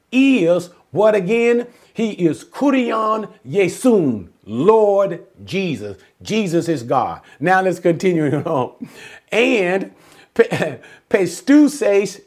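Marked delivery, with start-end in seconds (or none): none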